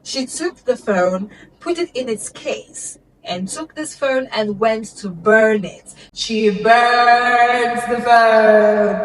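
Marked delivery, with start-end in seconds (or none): 6.1-9.0 slow, loud, drawn-out for drama; dramatic reveal. A narrator's reading. Telling viewers the climax, slow loud dramatic emphasis. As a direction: lean hard on every word. Mom burning the phone.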